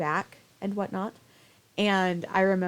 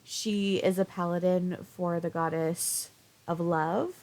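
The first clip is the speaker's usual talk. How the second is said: usual speaking voice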